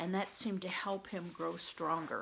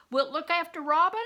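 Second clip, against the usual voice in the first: calling across to the carpenter shop, higher and louder; 8 semitones higher, 11 dB louder than usual. Acted. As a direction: call out to a monk working far off. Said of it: "he called to the monk".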